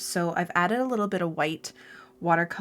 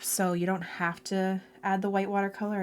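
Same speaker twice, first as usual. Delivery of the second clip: ordinary talk, nothing marked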